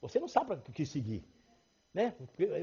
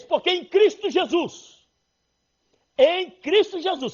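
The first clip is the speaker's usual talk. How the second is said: slow, loud, weighty reading of the verse; emphatic scripture reading; 11 semitones higher, 9 dB louder, slower than usual. Acted. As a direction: lean on every word of the verse. (leitura enfática e lenta do versículo)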